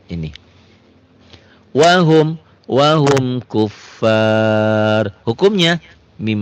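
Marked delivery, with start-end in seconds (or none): 1.7-5.1 slow, melodic tajwid chanting; chanted Quranic reading; Quran recitation. Shown as a demonstration. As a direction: recite it slowly with drawn-out vowels. Recites example loudly.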